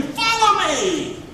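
high, slow call of God; preacher voicing God's invitation; soaring call. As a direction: stretch it out like a summons.

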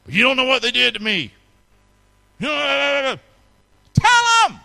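high, loud urgent cry; full-force preaching. Real, not acted. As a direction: shout it out over the congregation. Preacher erupts, urging parents.

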